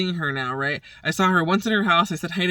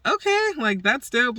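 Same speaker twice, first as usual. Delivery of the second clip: impressed excitement; 8 semitones higher than usual. Retold or imitated. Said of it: "I'm like".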